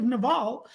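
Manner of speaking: "Naval" said nuh-VAHL; fancy pronunciation. Shown as a demonstration. Co-host teases that it is fancy, "Naval" as nuh-VAHL. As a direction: stress the ah in the second syllable of "Naval". Mock-adopts the corrected pronunciation.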